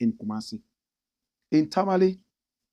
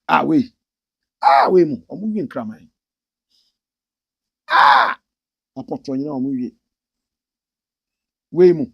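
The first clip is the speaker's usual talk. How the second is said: mock jeering; 9 dB louder than usual. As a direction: jeer like a schoolyard bully. Mocking Southerners insulting Northerners.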